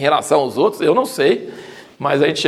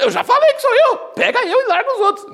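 much higher, mock-exasperated Jesus voice; hammed-up Jesus saying he already told them. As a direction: play Jesus fed up, insisting he is the one. Jesus voiced in retold Gospel scene.

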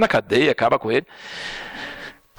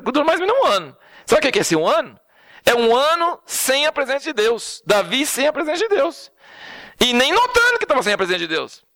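fervent preaching; 11 semitones higher than usual. Acted. Preaching peak on God's absence.

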